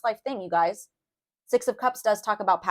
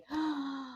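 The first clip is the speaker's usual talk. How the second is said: sharp gasp; 7 semitones higher, 10 dB quieter than usual. Real, not acted. Moved by the card.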